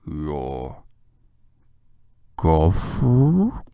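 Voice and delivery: very slow, low, then loud break; a little afraid. Acted. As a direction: ask timidly. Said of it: "in a little afraid voice".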